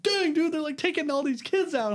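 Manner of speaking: high, surprised exclamation; amazed. Retold or imitated. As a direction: gasp it out in amazement. Retelling amazed reaction.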